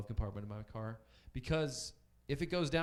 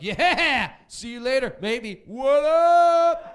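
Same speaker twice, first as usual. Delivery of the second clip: loud, much higher carefree goodbye yell; careless friends shouting; 14 semitones higher, 17 dB louder, much slower than usual. Retold or imitated. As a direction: holler a cheery goodbye, not caring at all. Careless friends waving drunk off.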